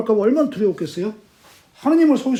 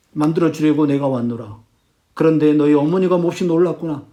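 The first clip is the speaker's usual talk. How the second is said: measured, solemn ghost speech, sinking lower; ghost father; 4 semitones lower than usual. Retold or imitated. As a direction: play a dead father instructing his son. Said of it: "홀령이". (점점 낮아지는 엄숙한 아버지 유령 말투)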